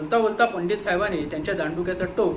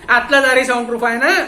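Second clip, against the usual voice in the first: loud, high barked question; sharp demand; 4 semitones higher, 10 dB louder than usual. Acted. Inspector demanding answers from the watchman, loud. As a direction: snap the question at him.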